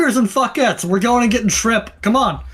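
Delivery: high, loud rallying shout; sharp call. Acted. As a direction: snap it at the crew. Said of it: "snaps back ... sharply".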